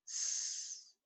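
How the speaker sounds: unvoiced whisper of the letter; soft whispered S. Shown as a demonstration. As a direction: breathe the S out without voice. Teacher spelling, whispered letter.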